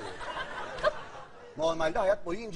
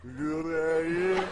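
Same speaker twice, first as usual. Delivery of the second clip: slow, much deeper voice after inhaling gas; 7 semitones lower, much slower than usual. Real, not acted. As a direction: drag the word out in a deep gas voice. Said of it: "Kalınlaşıyor sesi".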